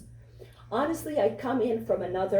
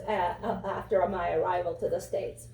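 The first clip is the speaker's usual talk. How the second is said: usual speaking voice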